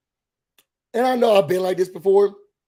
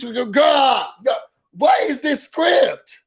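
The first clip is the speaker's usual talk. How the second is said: higher, drawn-out exasperation; exasperated outburst; 5 semitones higher, much slower than usual. Retold or imitated. Fed up with the endless phone argument, exasperated. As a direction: cry out, fed up with the whole exchange.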